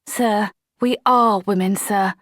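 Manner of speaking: slow, earnest confession. A narrator's reading. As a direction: say it plainly and sincerely.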